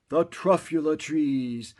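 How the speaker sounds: plain reading voice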